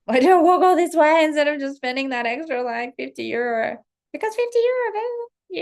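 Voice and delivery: loud, high-pitched mock outrage; hammed-up tantrum over a petty expense; acted angry rant. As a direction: whine it loudly, up high, at yourself. Acts out her angry inner rant.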